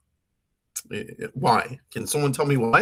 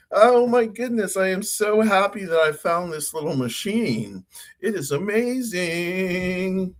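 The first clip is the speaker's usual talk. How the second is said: excited; 7 semitones higher than usual. Real, not acted. Thrilled with the voice machine.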